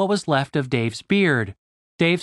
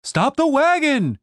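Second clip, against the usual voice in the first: much higher, slow shouted order; urgent cry; 11 semitones higher, slower than usual. A narrator's reading. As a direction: yell at the soldiers to stop. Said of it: "cried Julian".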